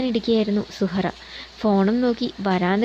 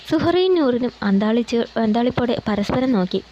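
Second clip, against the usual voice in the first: ordinary delivery, nothing marked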